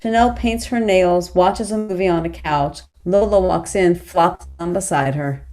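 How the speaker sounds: normal talking voice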